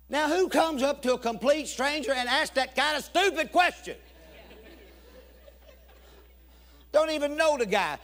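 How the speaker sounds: high, loud comic outrage; indignant. Real, not acted. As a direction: rant in disbelief at someone's nerve. Indignant at stranger's rude question.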